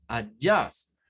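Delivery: Adjust with schwa, uh-JUST; English adjust. Shown as a demonstration. Teacher modelling Adjust for students. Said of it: "es un schwa y aquí es otra".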